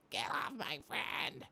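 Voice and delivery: imitating scrawny kid. Scrawny kid defends his friend.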